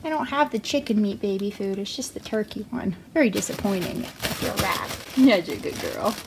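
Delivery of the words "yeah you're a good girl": ordinary delivery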